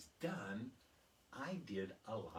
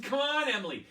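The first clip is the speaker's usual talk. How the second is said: put-on much higher, louder kids begging; urgent pleading; 13 semitones higher, 13 dB louder than usual. Classmates urging Emily to fetch the teacher, high and loud. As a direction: plead with her like a panicked kid.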